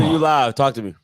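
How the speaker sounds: normal talking voice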